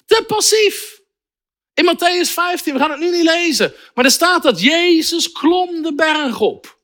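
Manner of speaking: high, urgent exhortation; impassioned preaching. Real, not acted. Preacher urging waiting believers to get moving, impassioned. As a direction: spur them on, pitch climbing high.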